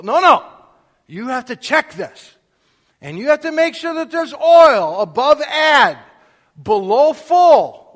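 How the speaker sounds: high and loud, ending very slow on below full; exasperated lecture. Acted. Father lecturing his son about checking oil, exasperated loud. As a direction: drill it into him, spelling out each point.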